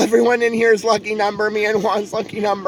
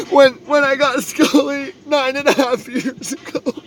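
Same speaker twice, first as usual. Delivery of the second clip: sobbing cry-out; 3 semitones higher than usual. Real, not acted. Telling stream viewers how Scully came, sobbing. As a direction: let the words crack open as you cry them out. Overwhelmed remembering God's plan.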